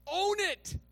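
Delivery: emphatic preaching. Acted. Preacher charging the church to own their faults. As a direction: drive it home.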